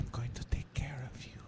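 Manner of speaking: imitating a soothing ASMR whisper; soft mock caring whisper. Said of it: "But it's more like".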